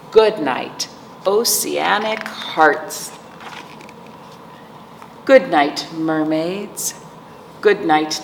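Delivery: usual speaking voice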